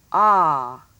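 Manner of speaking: ah as in sock; short O sound. Shown as a demonstration. Teacher announces the next sound, ah said open and loud. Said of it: "has the ah sound".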